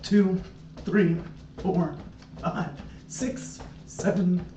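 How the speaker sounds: counting while doing jumping jacks; breathless, fading counts from exercise; out of breath. Real, not acted. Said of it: "hard to talk while doing jumping jacks".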